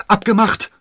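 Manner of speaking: high, loud quick shout; calling out. A narrator's reading. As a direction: shout your agreement on the run. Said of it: "rief D'Artagnan". (laut gerufen)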